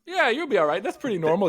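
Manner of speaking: lightly voiced wise advisor, pitched high; breezily reassure, as if quoting someone unworried; imitated casual reassurance. Voices wise person reassuring youth.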